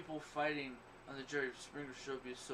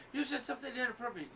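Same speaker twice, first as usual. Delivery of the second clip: higher-pitched imitation of a complainer; mock complainer; 8 semitones higher than usual. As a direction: lightly mimic an offended critic, pitched up. Mimicking people who complain at him.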